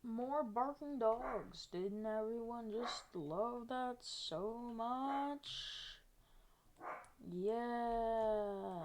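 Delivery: slow, drawn-out mock enthusiasm; sarcastic. Real, not acted. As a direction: stretch it out with heavy sarcasm. Sarcasm about barking dogs.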